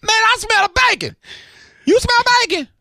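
high, loud call to a neighbor; excited neighbor yell. Acted. Host yells to his neighbor Ryan about the bacon smell.

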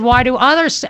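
ordinary talk, nothing marked

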